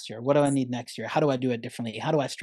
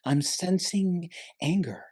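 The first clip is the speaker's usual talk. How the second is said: Olaf voice; 6 semitones higher, slower than usual. Retold or imitated. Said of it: "the snowman is like".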